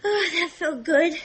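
winded, relieved after straining; breathless after pushing. Acted. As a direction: let it out after a hard push.

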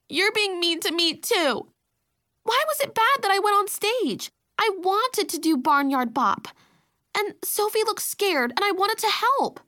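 high, loud tearful protest; upset little girl wailing her complaint; wounded, high-pitched outburst; crying little sister. A narrator's reading. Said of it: "Now Cam Esme was crying".

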